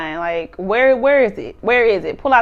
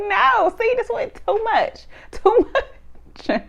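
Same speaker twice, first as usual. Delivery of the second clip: retold objection pitched way up; high-pitched mock protest; 11 semitones higher, much slower than usual. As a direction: squeal it lightly, amused by the retelling.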